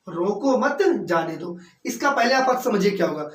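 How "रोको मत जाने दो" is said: slow "रोको मत", then a separate "जाने दो"; group मत with रोको; pause after मत. Shown as a demonstration. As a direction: break the phrase after मत. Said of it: "अगर यहाँ पर हम एक कॉमा लगा दें".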